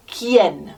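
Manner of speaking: "Quién" beginning with a hard k sound. Shown as a demonstration. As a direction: start "Quién" on a clean hard k. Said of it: "so it's a k sound".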